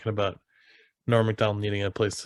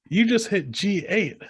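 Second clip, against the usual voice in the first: higher, slow imitation of an old man; lightly voiced old man pointing out a mistake; retold old-timer, pitched up; 8 semitones higher, slower than usual. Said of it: "the old man goes".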